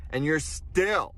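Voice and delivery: ordinary delivery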